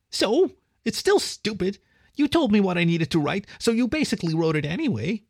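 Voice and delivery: put-on high, whiny, sulking boy; defiant little kid talking back; petulant. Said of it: "maintained his petulance".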